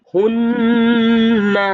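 হুন্না with the ন্ন hummed long through the nose; nasal ghunna. Shown as a demonstration. Teacher demonstrates ghunna on হুন্না for students. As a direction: hold the nasal hum in হুন্না. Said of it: "গুন্নাহ করে পড়তে হবে".

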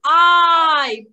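"Eye" said loud and clear as one long vowel sound; teacher modelling the vowel "Eye" loudly; phonics sound demo. Shown as a demonstration. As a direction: sound out "Eye" boldly for the kids. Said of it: "two sounds in it".

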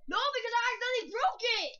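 loud protest. Real, not acted. Player denies cheating to a rival, loud protest.

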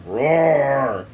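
loud imitated roar; long storyteller's roar, falling away; lion roar. A narrator's reading. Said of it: "to rock and roared".

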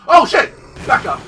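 loud startled shout. Real, not acted. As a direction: yelp it in sudden fright. Sudden zombie scare.

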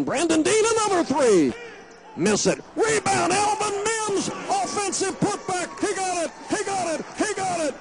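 crowd-lifting radio yell; excited shout. Real, not acted. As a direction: yell it as the putback falls. Rebound and putback call.

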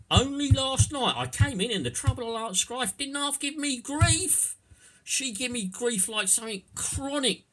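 high, loud Cockney grumbler; broad Cockney accent. Shown as a demonstration. Husband grumbling about his wife, broad Cockney complaint. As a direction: moan loudly as a hammed-up Cockney. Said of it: "pretending to be somebody else".

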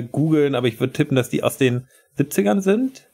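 ordinary delivery

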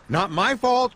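calling out. A narrator's reading. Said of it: "called Max".

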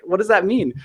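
mock outrage at the contradiction; high exasperated disbelief; incredulous disbelief. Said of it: "I was like".

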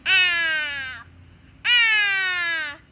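long open "ah" for the nurse; open-mouthed doctor's-check aah; drawn-out "ah". Pretend. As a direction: hold a loud aah, gliding slowly downward. Patient saying ah for nurse.

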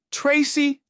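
high squeaky surprised cry; squeaking. A narrator's reading. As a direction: squeak the name out in delight. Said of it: "she squeaked".

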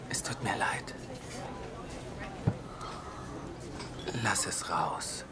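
hushed, gentle whisper; soothing whisper. Acted. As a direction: whisper to calm her tears. Comforting her during breakup. (beruhigendes Flüstern)

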